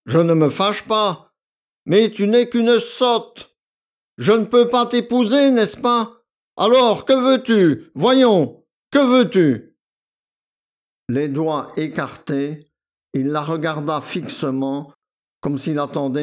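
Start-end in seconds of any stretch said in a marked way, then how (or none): 0.0-9.8 exasperated shouting. A narrator's reading. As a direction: berate her, losing patience. Victor s'emporte contre Akoulina. (Victor crie, exaspéré, voix plus aiguë et forte)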